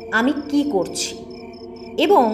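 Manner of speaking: plain reading voice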